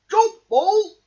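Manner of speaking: very high, loud shout upward. Acted. Badger shouts to Mole up the tree, loud. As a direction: call up to the treetop. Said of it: "shouted Badger".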